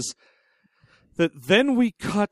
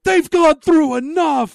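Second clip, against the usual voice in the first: high, loud, slow protective outburst; outraged producer; 11 semitones higher, 7 dB louder, slower than usual. Retold or imitated. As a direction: shout it like a producer defending his characters. Producer outraged on the characters' behalf.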